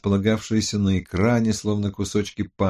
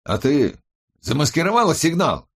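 raised, urgent pitch with a touch of alarm; anxious question; 6 semitones higher, 3 dB louder than usual. A narrator's reading. Colleague checks the signal was masked, anxiously. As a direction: ask it worriedly, voice lifting. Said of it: "с тревогой спросил Борис".

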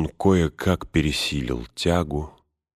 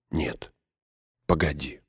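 soft, drained refusal; exhausted refusal; 7 dB quieter than usual. A narrator's reading. Spellbound-tired Frodo puts off Gollum, quietly drained. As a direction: barely push the words out, too tired. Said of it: "Неимоверная усталость сковала его".

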